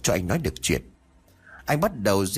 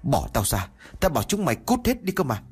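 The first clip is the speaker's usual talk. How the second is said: angry; 6 semitones higher than usual. A narrator's reading. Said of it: "Miệng của anh liên tục nói".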